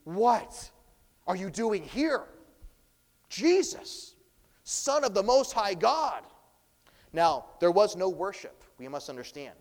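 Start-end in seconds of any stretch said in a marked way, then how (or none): 0.0-6.2 high, loud, startled cry of a cornered demon; a scared demon recognizing its master; frightened demon. Shown as a demonstration. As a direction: shriek the name Jesus in sudden fear. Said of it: "It also could be".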